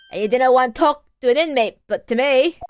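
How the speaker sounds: put-on loud, clumsy Irish accent; bad Irish accent. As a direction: belt it out in a badly faked Irish brogue. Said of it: "does he have an Irish accent".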